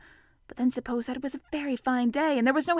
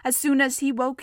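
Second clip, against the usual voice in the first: ordinary delivery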